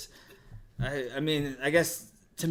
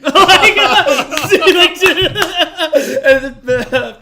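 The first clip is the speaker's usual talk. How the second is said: words squeezed out high between loud laughs; too amused to finish the sentence; helpless laughter; 16 dB louder, much slower than usual. Real, not acted. Cracking up at own punchline.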